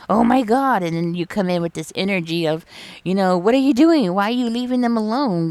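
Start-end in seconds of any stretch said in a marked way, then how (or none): 0.1-0.8 louder, higher gasp of shock; shocked outburst. Pretend. Client discovering the nurse left the patient, loud shocked outcry. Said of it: "and you're like". 3.4-5.5 loud, high demanding scold; angry confrontation. Pretend. Client scolding the nurse for leaving the patient alone, loud angry demand. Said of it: "that kind of energy".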